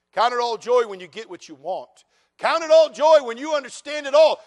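hammed-up false scripture, shouted; mocking misquote. Shown as a demonstration. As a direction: build the wrong version into a yell. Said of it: "It does not say".

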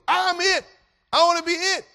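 put-on very high, loud kid voice; eager shout. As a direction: claim the turn at full voice. Excited boy claims his turn.

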